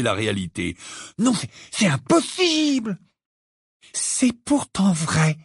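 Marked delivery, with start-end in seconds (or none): 1.2-3.0 disbelief. Acted. Ron disbelieving the giant news. 3.9-5.4 slow and firm, settling it; slow firm insistence. A narrator's reading. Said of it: "d'une voix ferme".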